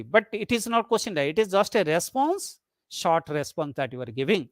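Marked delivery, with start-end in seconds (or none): none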